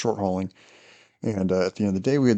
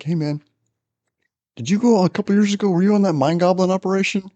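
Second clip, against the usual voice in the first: much higher, louder, fast innocent question; eager setup question; 10 semitones higher, 5 dB louder than usual. Retold or imitated. Reagan baits a new guy, high, loud and fast. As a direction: rush the bait like a genuine question. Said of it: "He's like".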